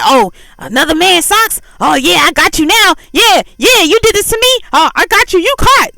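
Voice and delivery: high, loud mocking gotcha voice; jealous partner taunting, sure they caught a cheater; gloating accuser. Partner gloats over found socks.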